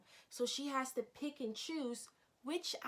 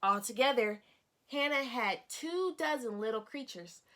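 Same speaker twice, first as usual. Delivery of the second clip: expressive picture-book narration for a class; storybook read-aloud. Acted. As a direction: lively, clear reading to young children. Teacher reads picture book aloud.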